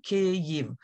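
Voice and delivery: Kyiv as KEE-yiv, two syllables. Shown as a demonstration. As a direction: say Kyiv cleanly in two beats. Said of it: "it's quite easy".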